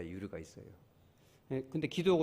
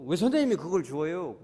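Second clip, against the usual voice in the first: louder, higher indignant question; mock anger; 4 semitones higher, 7 dB louder than usual. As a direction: imitate an annoyed woman demanding why. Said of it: "막 화를 내더라고".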